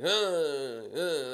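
long helpless groans, mouth unable to move; mimicked sleep-paralysis moaning; drawn-out groan imitation. Imitates stepdad's groans every few seconds.

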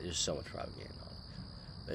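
whispered under breath. Real, not acted. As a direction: mutter it again, voiceless. Repeats line under breath.

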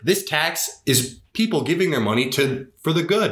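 high-pitched indignant insistence; retold disgust. Retelling an inner protest about church money, indignant. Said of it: "I remember I was disgusted".

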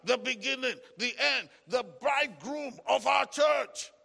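loud, driving litany of titles; forceful preaching. Acted. Preacher praising Christ title by title before the church, full-force proclamation.

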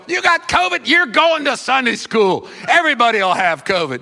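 loud, pushy parent overruling excuses; mock parental shout. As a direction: belt out a comic order to the kid. Voices parent forcing kid to church.

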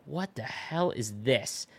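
hushed disbelief. Retold or imitated. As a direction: murmur it in awe. Stunned whisper to dad in bar.

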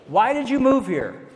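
incredulous question. Retold or imitated. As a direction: imitate a baffled local asking why. Strangers' question acted out in retelling.

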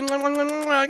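string of smacking kisses; big wet smooch. Retold or imitated. Blowing kisses to partner.

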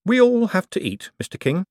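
high, eager banker politeness; Moist, polite banker. A narrator's reading. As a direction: keep the customer sweet. Moist speaks.

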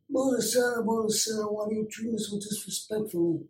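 high, soft movie-godfather impression; Marlon Brando. Party performer doing the Godfather scene, high soft mobster voice. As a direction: play the wounded mob don, pitched high and hushed. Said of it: "That was Marlon Brando".

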